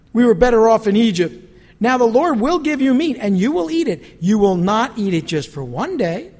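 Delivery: loud, proclaiming rebuke; forceful scripture reading. Acted. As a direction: thunder it out as God's word. Reading God's words forcefully.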